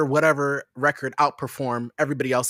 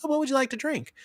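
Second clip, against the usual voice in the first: lightly voiced server; 11 semitones higher, faster than usual. Acts the server taking the order.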